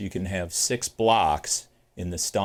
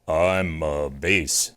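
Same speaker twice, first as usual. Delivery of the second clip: low and slow, showing off his bass; a booming low bass; deep bass voice; 5 semitones lower, much slower than usual. Shown as a demonstration. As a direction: drop into the lowest register, drawn out. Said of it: "Let me prove it".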